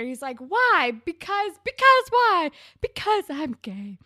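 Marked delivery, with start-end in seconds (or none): 0.4-2.5 high, loud mock interrogation; reenacted demanding inflection. Retelling Spinner demanding a reason, mock insistence. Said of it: "that was the inflection that happens". 2.8-4.0 imitated coming-out line, dramatic; mock-dramatic admission from a TV scene; reenacted confession inflection. Recreating famous Degrassi coming-out scene, imitated confession. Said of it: "that was the inflection that happens".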